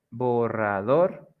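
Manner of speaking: "borrador" slowly split as bo-rra-DOR, rising; stress on last syllable. Shown as a demonstration. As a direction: draw out "borrador" up to a lifted dor. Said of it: "like in the examples".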